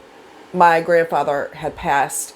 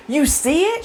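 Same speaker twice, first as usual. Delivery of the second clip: excited disbelief; 10 semitones higher than usual. Retold or imitated. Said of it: "I got so excited".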